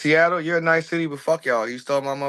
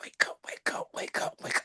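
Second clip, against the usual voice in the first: eerie unvoiced whisper; 12 dB quieter than usual. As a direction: breathe it like a ghost. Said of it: "coming out the water talking about".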